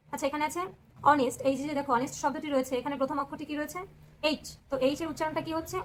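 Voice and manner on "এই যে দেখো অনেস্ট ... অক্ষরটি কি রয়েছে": ordinary talk, nothing marked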